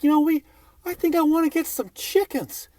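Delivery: high, airy mock of the chicken neighbors. Comic telling the crowd, mocking neighbors who want chickens, high and silly.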